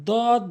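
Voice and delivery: "দদ" short, with a plain d; wrong pronunciation. Shown as a demonstration. Teacher shows learners the mistake, wrong short "দদ". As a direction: say "দদ" quickly, cut short. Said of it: "ভুল উচ্চারণ".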